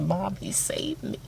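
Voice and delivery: slow hushed whisper. Retold or imitated. Daughter whispering to mom about rescuer. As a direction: breathe it out slowly.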